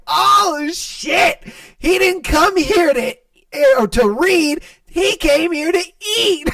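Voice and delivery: gleeful mock of the customer; mocking through laughter. Friends mocking the rude customer, cackling.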